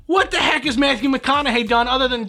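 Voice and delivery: high, incredulous outburst; exasperated rant. Real, not acted. Host dismissing an actor's qualifications for governor, exasperated high-pitched rant.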